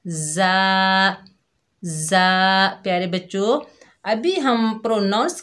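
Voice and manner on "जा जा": जा with firmness; a strong, held जा; जा said firmly; hard ja. Shown as a demonstration. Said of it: "इसे सख्ती से अदा करना है".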